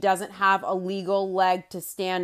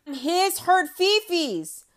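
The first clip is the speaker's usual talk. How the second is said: higher, slow, sneering mock-pity; mock his hurt feelings in a babyish voice; sarcastic sing-song scorn; mocking baby talk; 7 semitones higher, slower than usual.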